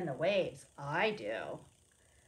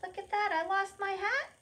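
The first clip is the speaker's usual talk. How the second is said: higher, quick woman's voice; voicing the woman; 12 semitones higher, 3 dB louder, faster than usual. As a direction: play the startled woman losing her hat. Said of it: "the woman said".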